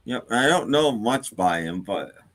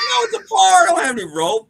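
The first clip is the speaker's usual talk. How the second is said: high-pitched, loud outburst in a joke; exasperated bartender; 8 dB louder, faster than usual. Acted. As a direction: shout it, at the end of your patience. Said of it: "starting to get really teed off at the duck".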